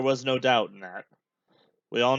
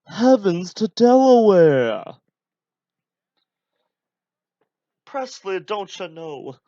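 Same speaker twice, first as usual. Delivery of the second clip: cartoon ghost voice; 6 semitones higher, 9 dB louder, slower than usual. Same cartoon voice, catchphrase shtick.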